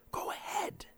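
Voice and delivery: put-on breathy child's whisper. A narrator's reading. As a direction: whisper it into the microphone. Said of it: "the boy whispered".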